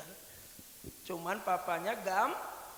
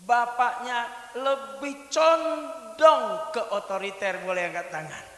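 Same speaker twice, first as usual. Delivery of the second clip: high, loud rousing address to the crowd; preacher urging hands up, laughing; preaching at full force; 8 semitones higher, 21 dB louder than usual. Acted. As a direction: fire up the congregation. Preacher rallies the congregation.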